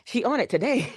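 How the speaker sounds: high, tickled admiration; put-on high voice, amused and impressed; impressed reaction. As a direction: playfully marvel at your busy friend. Acted admiring reaction to busy friend.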